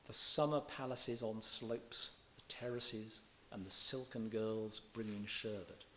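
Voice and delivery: slow, lingering on every S in "summer palaces" and "silken"; soft S sounds. Shown as a demonstration. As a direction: savour the S sounds slowly. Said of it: "Listen to the soft S signs".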